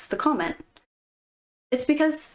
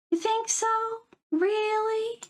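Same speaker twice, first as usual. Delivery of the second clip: much higher, coy pretend surprise; fake surprise; 11 semitones higher than usual. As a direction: squeak it like a girl pretending to be surprised. Girl fishing for compliments.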